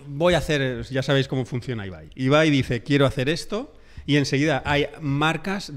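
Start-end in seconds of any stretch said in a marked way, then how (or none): none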